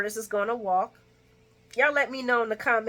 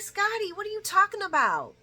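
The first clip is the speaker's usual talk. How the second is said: incredulous; 11 semitones higher than usual. Real, not acted. Speaker reacting to Scotty, high and incredulous. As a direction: scoff at him.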